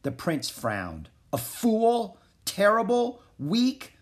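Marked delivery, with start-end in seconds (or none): 1.3-3.9 high, rising indignant questions; affronted disbelief. A narrator's reading. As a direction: echo each insult in offended disbelief. Prince offended at being called weak.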